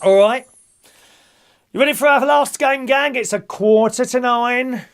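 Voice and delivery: loud, sing-song number calling; bingo caller. Acted. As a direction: call the numbers to the hall. Calls bingo numbers to players.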